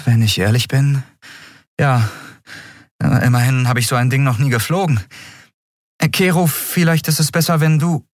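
put-on a young woman owning up to nerves; nervous young captain. Endriel voiced, nervous.

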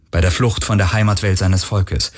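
ordinary delivery

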